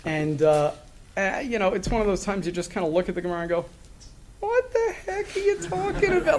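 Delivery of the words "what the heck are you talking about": very high-pitched, incredulous mock reaction; squeaky-high acted puzzlement at a confusing text; baffled disbelief. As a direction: blurt it out in exaggerated bewilderment.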